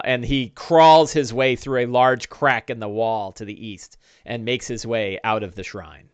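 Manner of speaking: usual speaking voice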